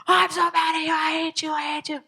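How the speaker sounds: high mock-tantrum kid voice; angry child. Child yelling at parent, high mock tantrum. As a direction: play the furious kid, high. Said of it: "angry child voiced".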